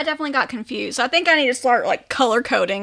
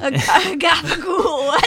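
put-on New Jersey Italian accent; 5 semitones higher, slower than usual. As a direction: belt out gabagool like a Jersey mobster. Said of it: "That's more New Jersey Italian".

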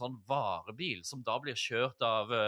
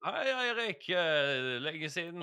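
casual, pitched-up chance-meeting hello; acted elevator greeting; 3 semitones higher, slower than usual. Employee greets boss Eirik in the lift, acted greeting. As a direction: play bumping into the boss. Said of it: "løpe inn i heisen og pitche inn den ideen".

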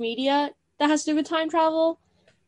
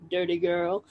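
lower, softer mock line; imitated male character; 6 semitones lower, 7 dB quieter than usual. Imitating the movie's man after a chuckle, low imitated voice. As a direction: drop low and quiet, imitating him. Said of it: "there's a part where he goes".

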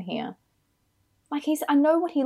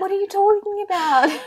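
high-pitched comic disbelief; incredulous outburst; 11 semitones higher, 4 dB louder than usual. Real, not acted. Co-host challenging his accent excuse, loudly incredulous. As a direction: push the question up high in exasperation. Repeats challenge, far more outraged.

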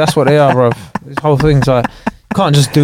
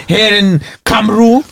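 higher-pitched mock Welsh; playful Welsh lilt, pitched up; Welsh accent; 6 semitones higher than usual. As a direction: put on a Welsh voice. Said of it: "so leeches have Welsh accents".

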